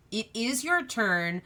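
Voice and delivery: normal talking voice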